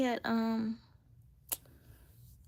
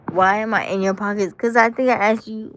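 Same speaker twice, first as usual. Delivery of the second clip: louder, hitting back; loud retort; 4 semitones higher, 14 dB louder than usual. Real, not acted. Answering the man's accusation with a loud retort. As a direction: throw the question back at him loudly.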